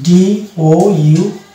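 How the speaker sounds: DBT spelled out very slowly, letter by letter; spelling out letters. Shown as a demonstration. Teacher spelling a word for students, very slowly. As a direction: draw out each letter of DBT. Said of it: "এরকম শব্দ পাওয়া যাবে".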